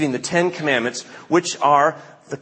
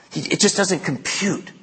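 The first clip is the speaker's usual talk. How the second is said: usual speaking voice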